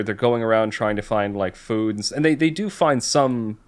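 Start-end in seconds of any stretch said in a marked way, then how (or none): none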